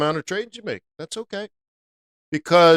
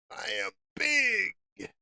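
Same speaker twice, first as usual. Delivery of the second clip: high, soft, drawn-out echo; hushed boast; 6 semitones higher, 10 dB quieter, slower than usual. Acted. Trader echoing his own catchphrase, hushed boast. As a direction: stretch it out slowly, high and small.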